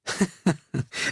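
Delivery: hohes, kaltes Maschinenlachen; mechanisches Lachen. A narrator's reading. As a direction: lach hoch und mechanisch. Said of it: "stieß ein mechanisches Lachen aus". (hohes mechanisches Lachen)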